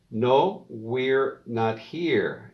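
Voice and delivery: "we're not here." very slow and clear; slow model answer. Shown as a demonstration. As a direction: drill it word by word. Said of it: "practique la pronunciación".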